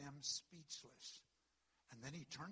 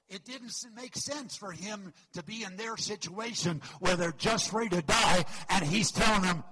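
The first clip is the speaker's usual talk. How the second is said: much louder and higher, near shouting; preaching at full force; 6 semitones higher, 21 dB louder than usual. Real, not acted. Pastor thundering to the church about the doomed boat, full-force preaching. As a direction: roar it over the storm. Climax of the storm sermon.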